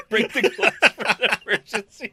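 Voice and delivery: high, loud, slow words through laughter; laughing hard. Real, not acted. Repeating a friend's emergency glass joke, laughing hard. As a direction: crack up while repeating the joke.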